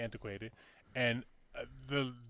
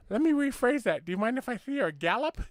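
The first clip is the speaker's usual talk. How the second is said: speech impediment voice; 8 semitones higher than usual. Retold or imitated. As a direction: deliver the punchline in the little man's impeded voice. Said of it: "he's got a fucking speech impediment".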